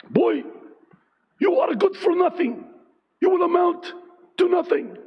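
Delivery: high, harsh fatherly taunt, hammed up; mean dad with an accent. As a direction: bark it down at the boy like a cruel father. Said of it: "he always said it in a mean ... accent".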